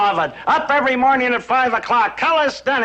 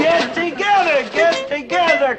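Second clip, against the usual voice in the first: drill sergeant barking; 3 semitones higher, much slower than usual. Acted. Father drilling his son and Maynard in calisthenics, barking commands. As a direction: bark the exercise counts at the recruits.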